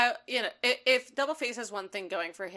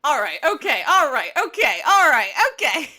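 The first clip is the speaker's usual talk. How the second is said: building, louder and higher each time; rising Mulaney-style exasperation, getting louder; John Mulaney impression; 4 semitones higher, 11 dB louder than usual. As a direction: ramp it up like a flustered John Mulaney. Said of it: "again, John Mulaney".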